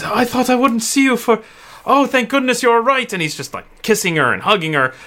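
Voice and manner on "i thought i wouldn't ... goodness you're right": higher, emotional father's voice; father clutching his found daughter; relieved father. Acted. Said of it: "he's like".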